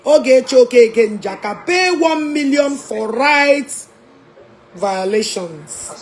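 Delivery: slow, formal headline read; news read. Acted. Reading the court news to her viewers, slow news voice.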